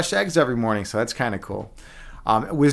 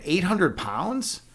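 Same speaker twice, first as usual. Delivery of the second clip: slow, higher, rising question; incredulous; 6 semitones higher, much slower than usual. Real, not acted. Streamer still can't accept how much buddy lifted, incredulous. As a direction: stretch it out in disbelief.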